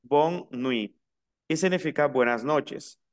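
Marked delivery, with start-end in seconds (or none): 0.0-1.0 "bonne nuit" in two slow parts, no T at the end; careful, slow "bonne nuit" with the T dropped; silent final T. Shown as a demonstration. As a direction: demonstrate "nuit" as "nwi", slowly. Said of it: "la T final no se pronuncia".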